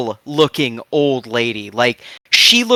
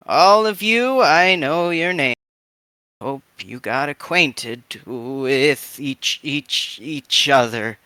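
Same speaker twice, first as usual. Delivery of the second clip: put-on frail old lady; slower than usual. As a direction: creak it out gently like a frail granny. GM voices old lady NPC.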